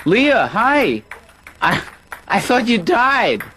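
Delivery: loud high greeting across the room; calling out. Real, not acted. Greeting old player across room.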